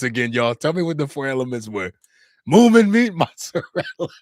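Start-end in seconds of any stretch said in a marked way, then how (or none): 2.5-4.1 loud, high outburst breaking into laughter; cracking up. Real, not acted. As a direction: read it out loudly, then lose it laughing. Reads silly chat answer, cracks up.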